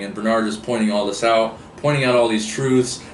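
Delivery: ordinary delivery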